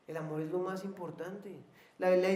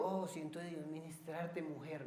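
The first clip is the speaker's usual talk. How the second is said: mock pious suitor; 9 dB quieter than usual. Man claiming God sends him to minister to a woman, mock pious. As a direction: imitate a man piously sidling up, softly. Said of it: "ningún hombre puede andar diciendo".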